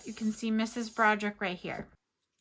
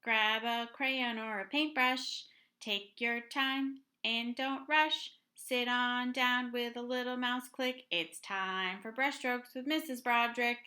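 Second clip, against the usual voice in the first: exaggerated cartoon intonation; 6 dB quieter than usual. Rhymed show intro.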